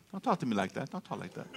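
lightly voiced small child scolding softly; imitating a little grandbaby. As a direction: softly mimic an indignant toddler talking back. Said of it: "little grandbaby".

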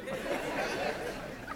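silent wheezing laugh; airy laugh under breath; breathless laugh. Real, not acted.

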